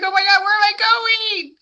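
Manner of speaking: high, quick panicky fish voice; scared little fish. Acted. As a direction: squeak it out like a frightened fish. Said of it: "He's like".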